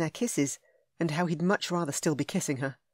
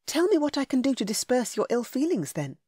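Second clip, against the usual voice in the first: heroine voice; 7 semitones higher than usual. A narrator's reading. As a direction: coax him gently.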